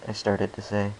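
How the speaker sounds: plain reading voice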